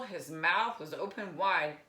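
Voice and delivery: ordinary delivery, nothing marked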